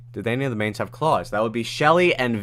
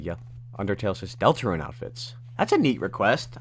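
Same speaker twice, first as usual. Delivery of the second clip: ordinary talk, nothing marked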